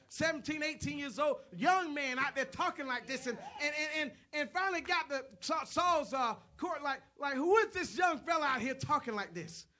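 loud, high, driving sermon delivery; full-force preaching. Acted.

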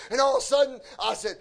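ordinary talk

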